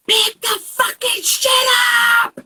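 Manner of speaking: high, loud exasperated mom yell. Retold or imitated. Mom blowing up at her kids over the mess, yelling. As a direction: blow up and holler it, drawn out. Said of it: "Why are you yelling?".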